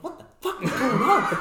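amazed laughing retelling; laughing disbelief. Retold or imitated. Retelling a shocking bar encounter.

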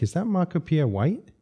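retold disbelief, lifted voice; astonished question. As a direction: ask it like a starstruck double-take.

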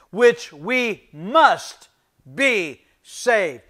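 slow, weighty reading of the verse; solemn scripture reading. Acted. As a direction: read the verse slowly, every word carrying weight. Reads scripture slowly.

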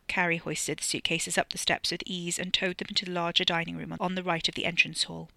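ordinary delivery

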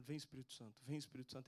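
low, soft repeated invocation; retold prayer. Man praying over a screaming boy in the street, soft low prayer. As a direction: re-enact praying softly over someone. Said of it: "que me veio de orar".

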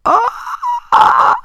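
sudden high yelp of surprise; surprised oh. Real, not acted. Startled mid-sentence, a sudden high yelp. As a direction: caught off guard, a sharp high cry.

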